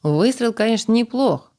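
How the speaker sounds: loud, high shout across the field; shouted call. Acted. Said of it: "Дастин крикнул".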